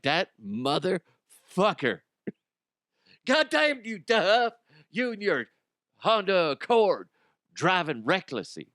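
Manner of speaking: high, hammed-up road rage; mock outraged driver. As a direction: squawk in pretend fury at a reckless driver. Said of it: "He's like".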